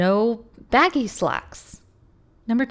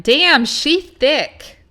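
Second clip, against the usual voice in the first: loud, high, drawn-out mock admiration; hammed-up slangy outburst, high and slow; modern slang exclamation; 6 semitones higher, 8 dB louder, much slower than usual. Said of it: "Had this been a modern writing".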